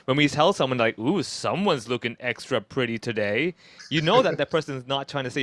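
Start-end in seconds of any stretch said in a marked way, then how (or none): 1.0-3.5 slow, drawn-out sarcastic compliment. Acted. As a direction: pay a compliment you clearly don't mean.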